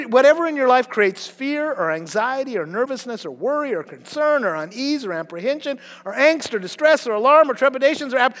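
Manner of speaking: usual speaking voice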